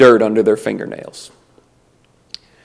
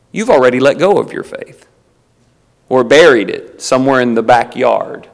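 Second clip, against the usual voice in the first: ordinary talk, nothing marked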